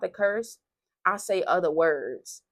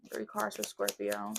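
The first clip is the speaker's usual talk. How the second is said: low playful voice; 9 semitones lower, 7 dB quieter than usual. Acted. Sing-song repetitions of card count.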